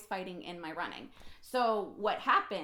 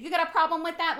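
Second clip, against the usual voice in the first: louder, daring anyone to object; defiant challenge; 6 semitones higher, 10 dB louder, faster than usual. Real, not acted. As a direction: throw it out as a dare. Defiant challenge after Easter story.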